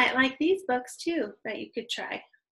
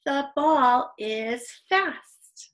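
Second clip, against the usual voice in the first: slow, drawn-out beginner reading aloud; careful, stretched read-aloud; slow model reading; 3 semitones higher, 4 dB louder, much slower than usual. Shown as a demonstration. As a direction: read word by word for new readers.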